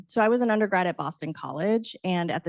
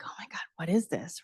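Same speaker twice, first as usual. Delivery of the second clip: softer whispered amazement; awed whisper; 2 semitones lower, 7 dB quieter, faster than usual. Retold or imitated. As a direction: breathe it in awe. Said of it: "I was just like".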